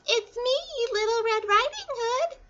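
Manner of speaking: very high put-on falsetto; fake little-girl voice. A narrator's reading. Wolf posing as Little Red to grandmother, fake girlish falsetto. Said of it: "in his best little girl voice".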